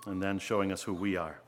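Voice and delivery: soft, earnest prayer voice, trailing off at the ends. Acted. Pastor praying over the congregation, soft and earnest.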